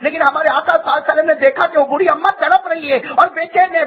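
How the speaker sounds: ordinary talk, nothing marked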